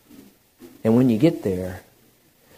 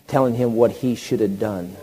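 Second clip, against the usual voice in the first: ordinary talk, nothing marked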